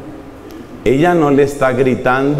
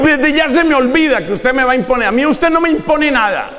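angry shout; 8 semitones higher than usual. Acted. New bride yelling at her husband outside the church, furious. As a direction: snap back at him, outraged, in a much higher voice. Bride quarrels with groom after wedding.

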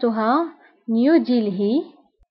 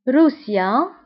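normal talking voice

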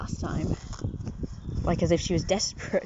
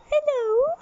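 much higher squeaky hello; high squeak to cat; 3 dB louder than usual. Real, not acted. Owner squeaks a hello to the cat. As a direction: squeak it to the kitty.

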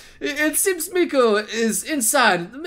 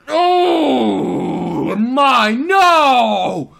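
long, loud drawn-out protest; dismayed wail; 5 dB louder, much slower than usual. Real, not acted. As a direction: stretch each word into a wail. Dreads the character's blunder.

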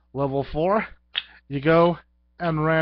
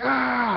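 mock scream; 11 semitones higher, 4 dB louder than usual. Victims react to the blow.

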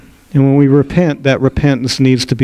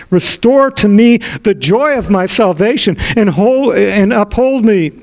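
pleading prayer; 5 semitones higher than usual. Acted. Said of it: "David begins to plead".

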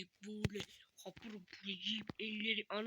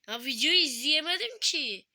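higher, louder complaint; frustrated outburst; 6 semitones higher, 12 dB louder than usual. Real, not acted. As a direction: protest loudly and high. Frustrated outburst after scare. (yüksek sesle sitem)

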